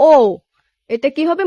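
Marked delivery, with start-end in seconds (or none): 0.1-0.1 "ও" sounded like the English letter O; English-style O. Shown as a demonstration. Teacher modelling a Korean vowel, "ও" like English O. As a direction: say a rounded "ও", as in English O. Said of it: "ও উচ্চারণ করি, ওই ওর মতো".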